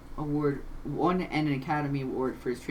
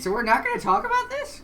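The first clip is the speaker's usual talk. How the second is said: incredulous; 6 dB louder than usual. Real, not acted. Teasing question.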